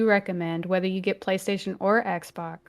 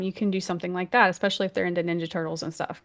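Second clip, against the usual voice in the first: usual speaking voice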